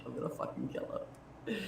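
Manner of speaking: whispered murderous threat, barely voiced; seething whisper. Real, not acted. Furious about the man, whispering she will kill him. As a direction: hiss it under your breath like a promise.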